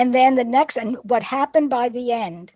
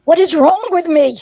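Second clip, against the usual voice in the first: put-on louder, higher, desperate boy's cry; despairing child; 6 semitones higher, 8 dB louder than usual. Dyslexic boy despairing over his reading, loud. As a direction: cry out in frustration.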